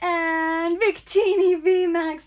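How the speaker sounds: loud, slow, thrilled reveal; excited. Real, not acted. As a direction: announce the big pull with joy. Finally pulls a good card.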